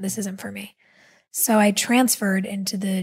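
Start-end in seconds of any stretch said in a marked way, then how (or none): 0.0-0.8 hushed, breathy whisper of quitting. Retold or imitated. Student quietly deciding to leave the program, whispered. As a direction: whisper it to yourself. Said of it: "Not the acting, but the program".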